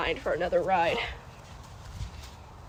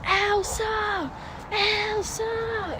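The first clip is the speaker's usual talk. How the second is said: imitating screaming girl; 13 semitones higher, much slower than usual. Retelling a little girl calling to Elsa at a parade, high childish mock scream. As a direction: pipe it up like a small excited child. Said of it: "was screaming at the top of her lungs".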